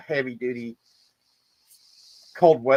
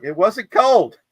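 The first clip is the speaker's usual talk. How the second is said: shrugging insistence; 9 semitones higher, 7 dB louder, slower than usual. Retold or imitated. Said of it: "And I'm like".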